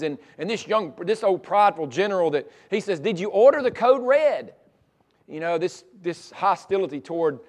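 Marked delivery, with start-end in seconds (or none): 3.0-4.5 higher, louder pointed question; lightly voiced lawyer. Young lawyer questioning the general on the stand, mildly provoking. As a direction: push his buttons with a raised challenge. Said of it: "trying to push his buttons".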